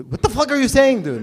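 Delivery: high, loud outburst of disbelief; exasperated shout. Acted. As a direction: burst in, baffled and exasperated. Acted exasperated interruption of Jesus's parable.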